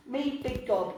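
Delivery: ordinary delivery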